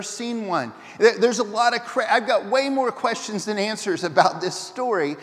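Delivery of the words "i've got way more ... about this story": ordinary talk, nothing marked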